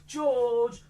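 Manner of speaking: usual speaking voice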